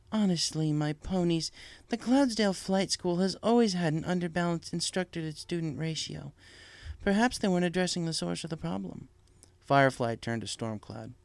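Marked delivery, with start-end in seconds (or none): 0.1-9.1 higher, kindly princess voice; lightly voiced princess; Celestia, gentle and regal.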